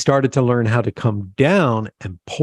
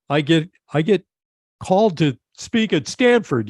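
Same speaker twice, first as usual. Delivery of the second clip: big New York guy voice, higher pitch; Tim's New York accent, pitched up; 5 semitones higher than usual. Retold or imitated. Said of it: "thick New York accent".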